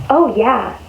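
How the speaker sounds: ordinary delivery, nothing marked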